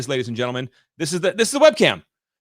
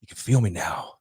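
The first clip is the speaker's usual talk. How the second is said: softer and lower, close to the mic; intimate close-mic voice; 6 semitones lower, 6 dB quieter than usual. Shown as a demonstration. Host leaning into the good mic to show its warmth, intimate and soft. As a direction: lean in and let it land slowly. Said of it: "when I slow it down, bring it in like this".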